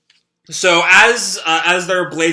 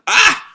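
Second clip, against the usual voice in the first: very high sharp yelp; startled shriek; 6 dB louder than usual. Acted. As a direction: squeal in alarm. Character shocked, cries out.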